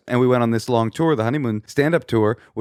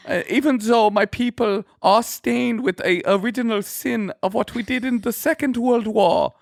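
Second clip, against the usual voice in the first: high-pitched comic German character voice; German accent; 10 semitones higher than usual. Shown as a demonstration. Improvising a German poo-poo fan on request, German accent. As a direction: earnestly confess in a high put-on German accent. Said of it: "how about German?".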